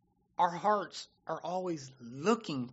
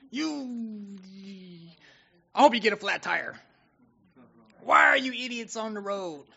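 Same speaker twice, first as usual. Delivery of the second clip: louder, higher mock road-rage yell; hammed-up angry driver shouting in traffic; road rage cursing; 4 semitones higher, 7 dB louder than usual. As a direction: bark at the idiots cutting you off. Cursing other drivers on freeway.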